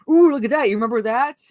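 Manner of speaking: high, loud gushing ooh; hammed-up member berries voice; mock nostalgia bait. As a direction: gush like a fan pointing at old toys. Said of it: "relies on nostalgia and member berries to go".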